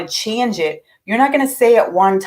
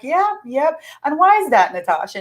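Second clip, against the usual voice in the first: knowing mom; 7 semitones higher than usual. Retold or imitated. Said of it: "And I'm like".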